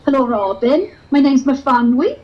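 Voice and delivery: put-on sing-song Welsh valleys accent; chirpy Welsh valleys girl. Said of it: "I'm from the valleys".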